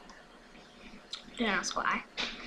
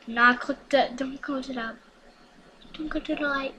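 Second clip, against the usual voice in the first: usual speaking voice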